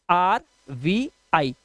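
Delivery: slow letter-by-letter spelling for the class; slow spelled letters. Shown as a demonstration. Teacher spelling letters slowly to students.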